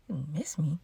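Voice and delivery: very soft, low question; tiny, stunned murmur; hushed disbelief. Retold or imitated. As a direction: ask it under your breath, taken aback. Taken aback by tender text.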